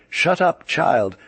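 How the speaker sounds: a high, scornful snap to silence him; sharp brush-off. A narrator's reading. Veladrappas silencing the Germanophile, a high scornful snap. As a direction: cut him off like scolding a child.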